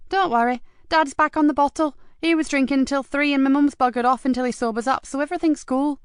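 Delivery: a cheeky lad asking keenly; young boy voice. A narrator's reading. Mickey asks Marcus eagerly, in a high young boy's voice.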